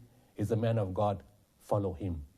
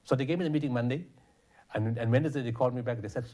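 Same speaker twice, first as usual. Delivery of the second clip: ordinary talk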